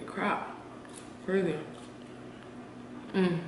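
chewing while humming; muffled closed-mouth chewing; mouth full of fries. Real, not acted. Seen 1: Eats fry at -3, cheeks full chewing through "Mm" until 1.1.

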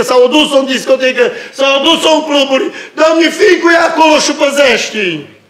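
put-on mother on her knees praying for her child, rising to a cry; pleading prayer.

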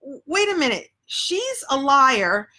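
stammered start, climbing high in outrage; indignant disbelief. Retold or imitated. Her twelve-year-old self reacting to the teacher, mock indignant. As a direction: protest the unfairness as a stung kid. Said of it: "And I remember thinking".

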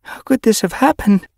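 high, loud grief-stricken cry; shaking voice. A narrator's reading. Mother asking how her son died, shaking voice. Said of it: "her voice shaking".

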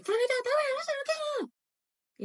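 high, sharp mock scolding; brusque Spaniard. Imitating a Spaniard addressing Fulanita, brusque high mock scolding. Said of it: "te lo dicen como muy bruscamente".

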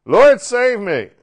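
loud, high, slow plea for help; desperate cry. Acted. Sinking Peter crying out to Jesus, loud and desperate. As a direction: call out as if going under. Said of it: "he cried out".

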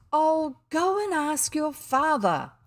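weary mother passing the buck; exasperated mother. Acted. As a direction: wave the child away. Mother waving William off.

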